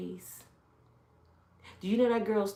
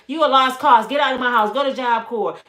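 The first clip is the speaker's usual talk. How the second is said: loud, higher mock scolding; acted angry mother; 4 semitones higher, 11 dB louder than usual. Mother kicking her daughter out to Job Corps, acted loud and angry. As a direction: throw her out in a mother's hard yell, imitated.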